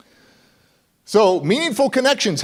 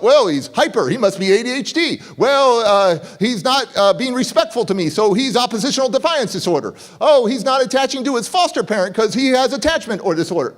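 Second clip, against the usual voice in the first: higher-pitched, mocking list of diagnoses; mock label-giver; 5 semitones higher than usual. Speaker mimics adults slapping labels on foster kids, mocking. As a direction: parody someone jumping to conclusions.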